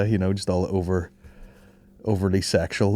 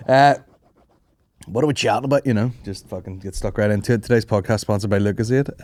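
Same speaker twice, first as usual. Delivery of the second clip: ordinary delivery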